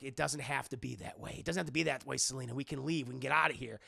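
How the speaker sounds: low, soft reassurance; hushed-down hero coaxing; acted gentle pleading. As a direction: talk them down low and softly. Bruce voiced, pleading with Selina.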